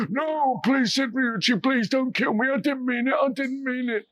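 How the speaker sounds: bully whimpering for mercy; pleading. Acted. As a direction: beg for your life. Captured killer begs for life.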